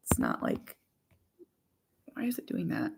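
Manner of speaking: whispered, puzzled; whispered frustration. Real, not acted. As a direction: mutter at the glitchy camera under breath. Camera problems.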